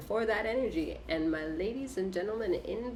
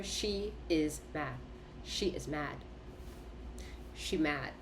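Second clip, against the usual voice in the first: low, deadpan; 4 semitones lower, 9 dB quieter than usual. Real, not acted. Reader to viewers about the card, soft deadpan. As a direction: let it drop low and soft. Repeating card meaning for effect.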